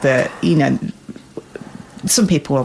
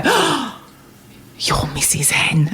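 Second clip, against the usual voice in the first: hushed, gasped recognition; mock starstruck, breath caught; imitated awed whisper; 4 dB quieter, slower than usual. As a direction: whisper it like a fan spotting someone. Acting out people recognising her.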